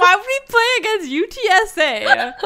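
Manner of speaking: high, laughing disbelief. Retold or imitated. Telling a friend about the odd football matchup, laughing. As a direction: giggle through the question. Laughing retold own thought.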